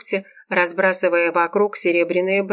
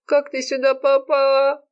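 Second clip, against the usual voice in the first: high tearful moan; moaning wail; 8 semitones higher than usual. A narrator's reading. Serafima asks the friend who found her, moaning. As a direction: wail the question in a high, miserable voice. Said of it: "простонала Серафима".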